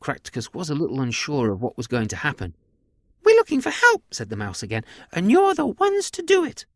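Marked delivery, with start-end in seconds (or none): 3.2-4.0 very high, loud squeaky mouse; high-pitched cartoon mouse voice; tiny alien mouse. Mouse repeats its plea. 5.1-6.6 very high, loud squeaky mouse; high-pitched cartoon mouse voice; tiny alien mouse. Mouse instructs the farmer.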